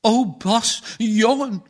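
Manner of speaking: a hint of a sob over her son; mother mildly sobbing over her hurt son. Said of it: "snikte de boerin".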